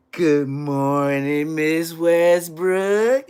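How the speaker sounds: hugely drawn-out greeting, WESTBROOK swelling upward; laid on thick. Retold or imitated. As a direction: overdo the sweet pupil, very slowly. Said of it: "he'll put it on thick".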